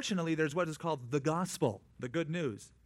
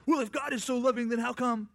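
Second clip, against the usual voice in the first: higher, louder, challenging questioner; mock skeptic; 9 semitones higher, 5 dB louder than usual. Preacher imitating people who question God, mock challenging. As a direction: throw it back like a doubter, mildly mocking.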